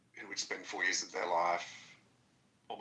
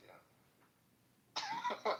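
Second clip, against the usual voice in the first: high squeaky mocking yeah.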